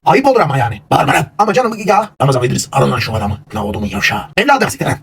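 high, loud furious yelling; angry shouting. Acted. The quarrel boils over into a high, loud, furious shout. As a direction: lose it and shout them down.

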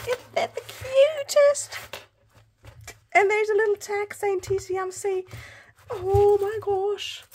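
high-pitched cooing over something cute; gushing squeal. Real, not acted. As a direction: squeal with delight, drawing it out.

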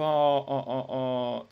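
"ah" sung out open and loud; open O demo. Shown as a demonstration. Teacher modelling the open O vowel for choir, loud. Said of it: "always gonna be open O".

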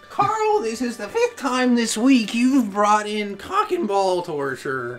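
high squeaky teacher whine; cute anime streamer; anime girl voice. Acted. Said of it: "my Carl impression is a Carl impression".

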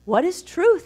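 curious question. Retold or imitated. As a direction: toss the question up high and searching. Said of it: "So Pontius is curious".

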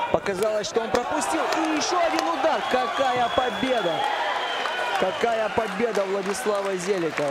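sudden rise to a thrilled call; excited commentary. Real, not acted. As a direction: react to the upset knockout, voice climbing. Commentator reacts to surprise knockout.

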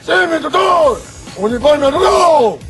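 shouted reply. Real, not acted. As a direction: snap back at the instructor. Cadet answering instructor at attention.

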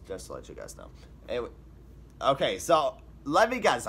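normal talking voice

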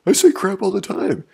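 high, loud mock kid voice; imitating an arguing kid. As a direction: mimic a kid protesting at full volume. Mimics kid arguing back loudly.

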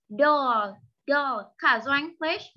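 ordinary delivery

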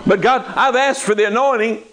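high, whiny plea to God; pleading believer. Acted. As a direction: beg God like a frustrated believer.

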